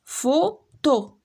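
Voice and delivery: فوتو said slowly as fo-to, two separate beats; syllables clapped out. Shown as a demonstration. Teacher to pupils, counting syllables: فوتو split slowly into fo-to. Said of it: "دو سيلاب".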